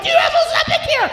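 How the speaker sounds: high, frantic yell at the pharmacy counter; frantic shout. Retold or imitated. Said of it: "being like".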